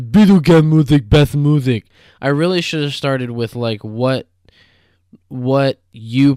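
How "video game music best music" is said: loud, eager declaration; excited quote. Retold or imitated. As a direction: proclaim it loudly with excitement. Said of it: "I was like".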